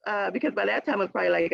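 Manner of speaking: ordinary delivery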